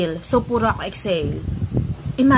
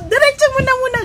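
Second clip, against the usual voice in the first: high, loud command; urgent order; 7 dB louder, faster than usual. Retold or imitated. To the driver, a high loud order. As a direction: tell him to keep driving. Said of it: "Sabi ko".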